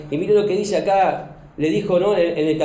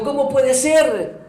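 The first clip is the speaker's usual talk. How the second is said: higher, louder indignant protest; protesting to God; 8 semitones higher, 4 dB louder than usual. Pretend. The wife as she might have rebelled against God, indignant. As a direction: voice a wounded complaint at heaven. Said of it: "Como, ¿no?".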